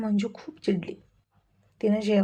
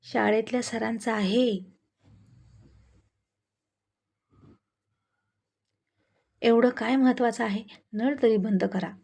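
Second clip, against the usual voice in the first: irritated. Acted. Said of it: "चिडूनच म्हणाली".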